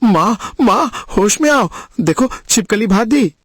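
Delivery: urgent calling to revive someone; understated worried shout; mild alarmed cry. A narrator's reading. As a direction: call out anxiously, without much volume. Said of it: "धीरज चिल्लाया".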